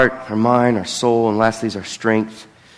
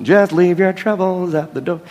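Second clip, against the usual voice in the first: odd put-on voice, higher pitch; second wrong imitation; 6 semitones higher than usual. Pastor recalling an old church phrase, in a strange put-on voice. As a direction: miss the tone of the old church saying. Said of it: "they didn't say it like that either".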